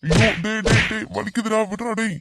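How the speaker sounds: high, wailing plea; pained begging. Acted. Kidnapper hurting, begging to be let go, pained wail. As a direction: cry out while being hit. Kidnapper hurt, begs release.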